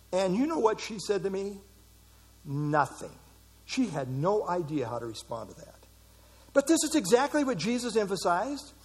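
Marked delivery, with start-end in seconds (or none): none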